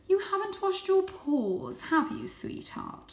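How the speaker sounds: very high, slow, sweet motherly voice; doting mother. A narrator's reading. Mother cat fusses over her kitten, high and doting. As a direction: coo at a small child. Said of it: "said Mother".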